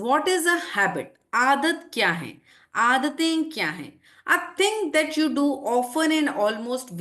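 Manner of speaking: slow, deliberate reading of a definition; slow dictation. Shown as a demonstration. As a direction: read it out slowly so students can follow. Reads definition to the class.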